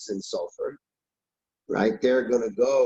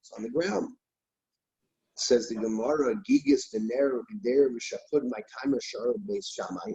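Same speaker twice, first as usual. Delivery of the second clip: ordinary talk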